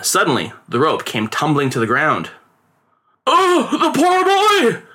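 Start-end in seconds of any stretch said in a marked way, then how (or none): 3.3-4.8 very high, drawn-out wail; hammed-up anguished exclamation, stretched long; cry of alarm. A narrator's reading. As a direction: cry out in horror, voice soaring. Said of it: "cried the father in alarm".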